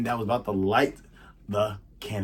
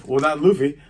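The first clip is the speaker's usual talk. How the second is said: ordinary delivery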